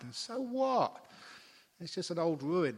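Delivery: so what, thrown out loud and high, then slow; mock dismissive. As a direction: wave it all away like a jaded tourist. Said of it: "she's from England".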